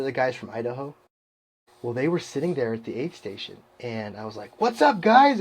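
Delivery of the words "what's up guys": high, bouncy re-enacted greeting; pumped-up hello in a raised pitch; jazzed greeting. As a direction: call out to the guys, all jazzed. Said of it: "I was all jazzed".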